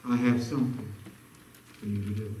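very low, hushed murmur; low and soft, with a pause midway; small still voice. Acted. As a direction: imitate God's quiet prompting, softly. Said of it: "still whispering in that small little voice".

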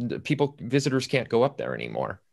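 normal talking voice

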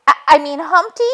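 high, anxious hen voice rushing through it; Chicken Little, flustered. A narrator's reading. Hen telling the detective what she saw, high and flustered.

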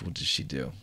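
normal talking voice

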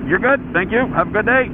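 high, chirpy mock cop; sing-song retold brush-off; breezy dismissal. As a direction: send him off with mock cheer.

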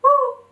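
high, loud owl hoot. Acted. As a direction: hoot to call the sun. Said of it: "she hooted for the sun".

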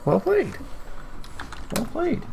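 a hammed-up high voice for a cheeky well played; silly put-on voice. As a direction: pitch way up and play a character saying well done. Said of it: "I'm sorry, if I do accents as well".

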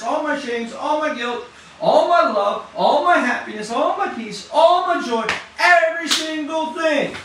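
prayer building from firm to a loud, high cry; rising impassioned prayer. Acted. Preacher voicing the congregation's prayer, building to an impassioned cry. Testimony sermon, prayer built to climax.

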